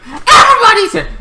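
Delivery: high, fast excited call. Retold or imitated. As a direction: blurt it out high. Excited about the crowd.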